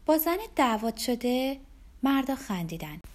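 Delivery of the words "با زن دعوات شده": higher, teasing question; sarcastic jibe. A narrator's reading. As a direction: needle him about his home life. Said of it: "ربکا به کنایه گفت".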